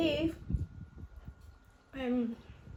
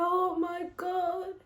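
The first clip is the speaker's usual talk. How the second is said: tearful oh my God; 6 semitones higher, slower than usual. Retold or imitated. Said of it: "And I started to cry".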